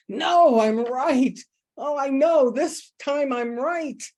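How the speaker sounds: cling to being right; stubborn insistence. Retold or imitated. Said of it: "and go".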